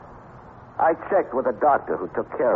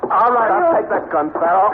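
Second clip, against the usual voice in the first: higher and louder, a triumphant cry as he grabs the gun; sudden shout; 14 semitones higher, 5 dB louder than usual. Acted. Mike disarms the wounded Farrell, shouting in triumph. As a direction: bark it out, seizing the moment.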